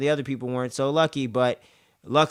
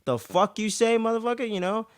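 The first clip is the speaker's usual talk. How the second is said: high, outraged retort; pissed-off mother; 10 semitones higher than usual. Retold or imitated. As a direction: tell him off, disgusted. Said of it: "disgusted and pissed off".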